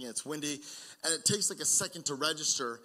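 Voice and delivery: ordinary delivery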